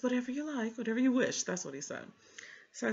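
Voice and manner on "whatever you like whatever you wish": higher, lazy mock of a shrugging clerk; mock nonchalant librarian. Mocking the librarian's offhand brush-off, higher pitched. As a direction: imitate him brushing her off.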